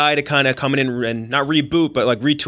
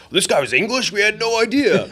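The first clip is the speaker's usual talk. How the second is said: 1 surprised American fan; 8 semitones higher than usual. Retold or imitated. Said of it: "They're always like".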